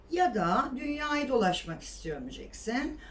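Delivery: ordinary delivery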